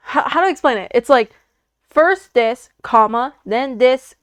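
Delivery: normal talking voice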